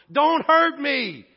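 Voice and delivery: loud, high, drawn-out plea; begging shout. Acted. Possessed man begging Jesus not to hurt him, loud pleading cry. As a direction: cry it out slowly at full voice. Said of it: "he's begging Jesus".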